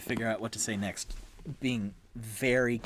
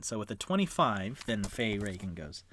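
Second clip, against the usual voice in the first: ordinary talk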